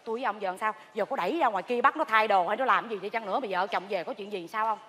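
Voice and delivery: low, soft anxious consulting; hushed worry. Acted. Asking a confidant what to do now, hushed worry. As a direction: keep it down, fretting. Worried aside about what to do.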